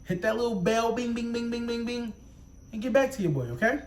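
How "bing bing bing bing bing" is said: ordinary delivery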